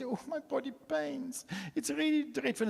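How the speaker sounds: mock moan of a wife in pain; complaining wife. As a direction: play the wife moaning about her aches. Wife's morning complaint retold.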